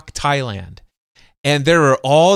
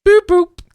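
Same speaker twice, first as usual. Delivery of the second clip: high, loud double boop; electronic boop imitation; 8 dB louder than usual. Radio host imitating the Echolink app connecting, high boops. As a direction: mimic the app's connection beeps. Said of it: "And then it goes".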